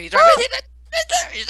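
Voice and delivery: very high loud slow scream; screaming warning. Real, not acted. Teammate chased, panicked warning.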